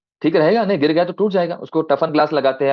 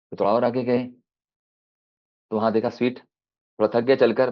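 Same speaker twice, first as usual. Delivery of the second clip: ordinary talk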